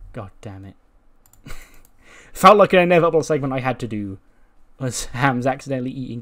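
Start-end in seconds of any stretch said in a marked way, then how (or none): 0.1-0.8 low, soft, resigned curse; defeated mutter. Acted. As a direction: drop the voice, beaten by bad luck. Acted defeat after shirt stain.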